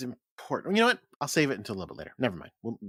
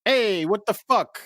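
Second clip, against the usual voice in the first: loud, higher mock New York accent; fake New York accent; 8 semitones higher, 6 dB louder, slower than usual. Doing an impression of New Yorkers, loud fake accent. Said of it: "that fake accent I just put on".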